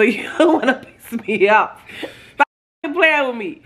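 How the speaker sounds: slow, high, drawn-out warning; irritated warning. Real, not acted. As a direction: stretch it out, fed up and teasing. Warning him she's irritated.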